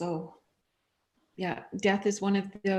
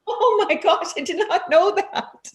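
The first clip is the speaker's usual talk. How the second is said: amazed; 11 semitones higher, 6 dB louder than usual. Retold or imitated. Retold amazed reaction, laugh cry.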